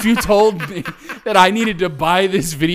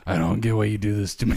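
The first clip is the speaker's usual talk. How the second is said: much lower, quick pleading whine; mock defeated dad; 10 semitones lower, 4 dB quieter, faster than usual. Friend imitating the dad in the video, lower and whining. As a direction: whine it low like the beaten father. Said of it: "his face is in the video".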